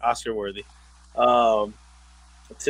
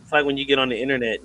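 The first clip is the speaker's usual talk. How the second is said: ordinary talk, nothing marked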